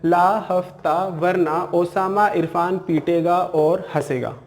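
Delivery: slowly repeated memory phrase; deliberate teacher repetition; slow repeat of mnemonic. Shown as a demonstration. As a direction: say the whole trick again slowly. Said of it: "फिर से बोल रहा हूँ मैं".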